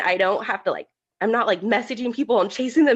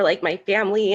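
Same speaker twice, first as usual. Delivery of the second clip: ordinary talk, nothing marked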